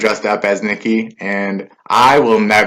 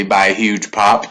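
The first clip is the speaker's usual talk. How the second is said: ordinary talk, nothing marked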